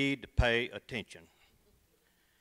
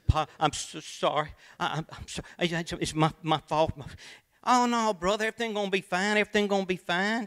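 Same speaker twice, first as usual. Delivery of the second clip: flustered apology; 5 semitones higher, faster than usual. Acted.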